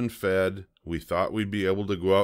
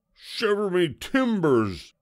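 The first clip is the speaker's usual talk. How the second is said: high-pitched yell of a shocked pirate; 12 semitones higher, 3 dB louder than usual. A narrator's reading. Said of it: "he yelled".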